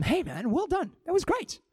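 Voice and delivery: mean every word of the praise; sincere praise. Shown as a demonstration. Preacher demoing sincere encouragement to a friend. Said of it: "But if I say to them".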